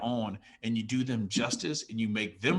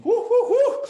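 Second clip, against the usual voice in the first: emotional blowing breaths; 10 dB louder than usual. Real, not acted. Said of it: "well, I'm getting emotional".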